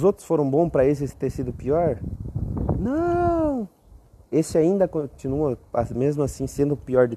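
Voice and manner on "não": high, exaggerated shout of yes; answering his own question theatrically; emphatic yes. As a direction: sing out the answer.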